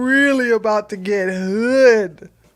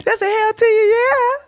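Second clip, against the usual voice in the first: hyped up; 10 semitones higher than usual. Acted. Rising playful exclamation.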